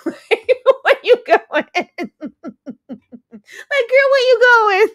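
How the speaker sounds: can barely get it out for laughing. Real, not acted. Asking Wanda where she's going, laughing hard.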